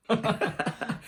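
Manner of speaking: a high burst of laughter; a short, high giggle. Real, not acted. Laughing about Riquette.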